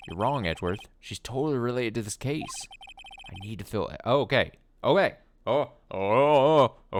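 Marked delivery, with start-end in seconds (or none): 4.8-7.0 startled oh. Real, not acted. Surprised reaction while playing.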